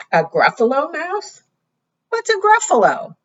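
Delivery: puzzled snake voice. Acted. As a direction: squeak out the question as the snake. Snake asks in disbelief.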